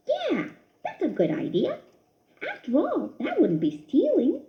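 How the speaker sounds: high little mouse voice talking himself into it; a mouse justifying a sly trick; scheming little mouse. A narrator's reading. Christopher voiced, scheming aloud.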